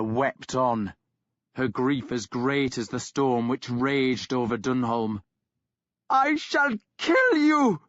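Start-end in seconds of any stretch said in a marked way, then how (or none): 6.1-7.8 slow, shrill-high, much louder accusing scream; furious scream. A narrator's reading. Sister screaming at her brother for leaving her, furious. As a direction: hurl it at him, wild with grief. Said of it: "she screamed at Ragnar".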